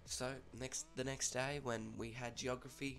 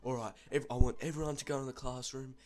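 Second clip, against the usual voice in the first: louder, teacher laying down the law; mock stern order; 3 semitones higher, 7 dB louder than usual. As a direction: imitate a fed-up teacher giving orders. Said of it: "he's not having a bar of that".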